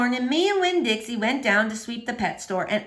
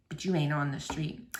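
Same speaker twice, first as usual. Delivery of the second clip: low soft Otis; 9 semitones lower, 9 dB quieter than usual. A narrator's reading. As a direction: lower and softer, a lonely gentle man. Otis speaks.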